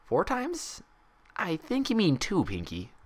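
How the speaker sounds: a bright pony correcting her friend; Twilight's voice. Acted. Twilight gently correcting Pinkie's count.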